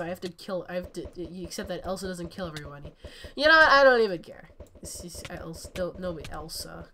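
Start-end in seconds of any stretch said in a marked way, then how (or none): 3.4-4.5 loud, high exclamation; sudden loud defiance; defiant outburst. Real, not acted. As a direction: blurt it out loudly, done with caring. Defiant outburst after Elsa bit.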